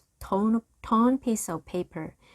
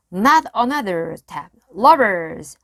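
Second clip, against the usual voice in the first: angry threat; 2 semitones higher, 9 dB louder than usual. A narrator's reading. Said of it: "pirate aims pistols".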